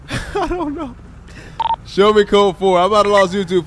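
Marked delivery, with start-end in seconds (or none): 0.1-1.0 slow words through a laugh. Real, not acted. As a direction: laugh while shrugging it off. Laughing at girth talk.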